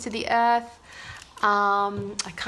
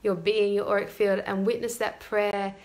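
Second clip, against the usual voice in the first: guided meditation. Acted. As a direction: ease them gently into the prayer.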